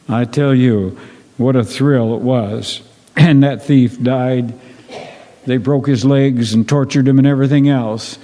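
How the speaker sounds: ordinary talk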